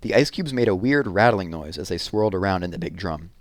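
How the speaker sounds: ordinary delivery, nothing marked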